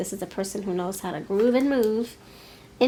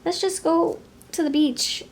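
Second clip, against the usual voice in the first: high, loud, stammering objection; flustered protest; 5 semitones higher, 3 dB louder than usual. Retold or imitated. Listener balking at the hotel key idea, high flustered stammer. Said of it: "And you're like".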